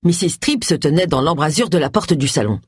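plain reading voice

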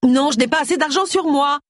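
high-pitched indignant refusal; protesting. A narrator's reading. Borrower fending off her creditor in the shop, protesting high. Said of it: "protesta Gloria".

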